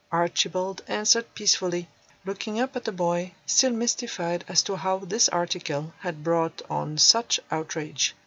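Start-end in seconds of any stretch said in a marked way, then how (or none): none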